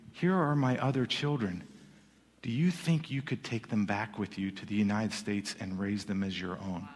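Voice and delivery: soft, low voicing of a father's request; subdued plea. Imam entrusts children to former enemies.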